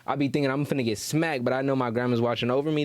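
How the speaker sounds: ordinary delivery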